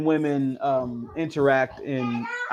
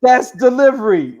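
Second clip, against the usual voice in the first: loud delighted exclaim; excited exclamation; 8 semitones higher, 10 dB louder than usual. Real, not acted. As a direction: shout it in delight.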